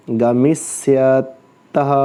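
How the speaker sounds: गमिष्यतः slowly stretched for pupils to copy; slow dictation. Shown as a demonstration. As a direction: draw out गमिष्यतः syllable by syllable. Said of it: "दूसरा बोलो".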